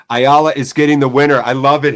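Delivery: loud hopeful cheer; excited loud prediction. Real, not acted. Hoping for a late winner.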